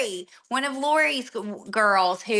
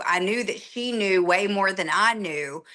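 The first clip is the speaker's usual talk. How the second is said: ordinary talk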